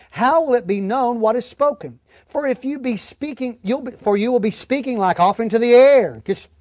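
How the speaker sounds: usual speaking voice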